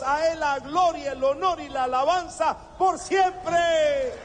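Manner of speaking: prayer building from earnest to a high shouted climax; fervent preaching prayer; rising prayer. Acted. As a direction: pray aloud, building to full force. Leads closing prayer.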